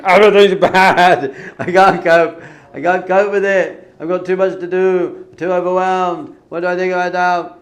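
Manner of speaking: high, very loud, overwhelmed wailing; loud panicking inner voice. Acted. Anxious inner voice drowning out the soul, loud panicked shouting. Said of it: "you got the louder voice saying".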